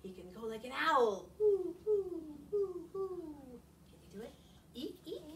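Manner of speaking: lively picture-book reading with big sound words; expressive read-aloud. Acted. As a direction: read it to the kids with full expression.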